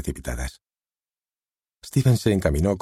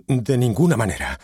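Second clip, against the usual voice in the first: firm, louder and higher refusal; exclaiming; 5 semitones higher, 4 dB louder than usual. A narrator's reading. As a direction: shut the idea down flat. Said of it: "exclamó Steven". (exclamando con firmeza)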